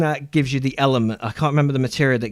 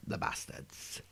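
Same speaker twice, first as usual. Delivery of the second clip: muttered curse; 18 dB quieter, slower than usual. Real, not acted. Grumbling at the game's ability costs.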